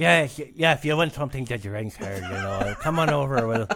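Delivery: put-on loose, muddled Scottish accent, half laughing. Guest offering a drink in character, amused stab at a Scottish accent. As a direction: attempt a Scottish lilt, losing it into laughter. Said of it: "putting on a Scottish accent".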